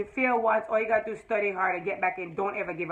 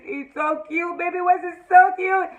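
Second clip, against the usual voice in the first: gushing; 6 semitones higher, 4 dB louder, slower than usual. Real, not acted. Gushing to viewers about the cute baby, high and cooing. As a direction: melt over how cute he is.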